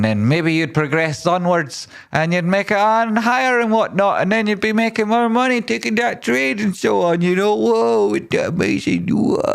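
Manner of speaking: high, sing-song silly voice; comic falsetto accent, swept away; put-on excited accent. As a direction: climb into a very high mock-dreamy voice. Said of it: "say it in your own accent".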